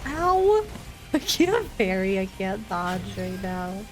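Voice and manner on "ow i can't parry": ordinary delivery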